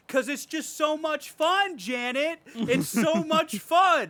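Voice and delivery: high, drawn-out, gleeful exclamation; worked-up interviewee's insistence; excited Quentin Tarantino. Retold or imitated. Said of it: "Quentin Tarantino's like".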